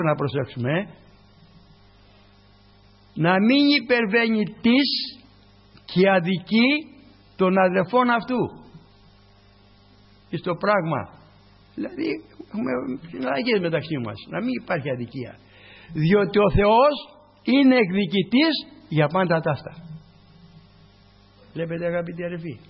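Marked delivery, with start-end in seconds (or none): 3.1-8.6 higher, louder, slow proclamation; scripture reading. Acted. Reads scripture aloud in sermon. 15.9-19.7 loud, slow warning; preaching at full force. Acted. Preaching God's vengeance.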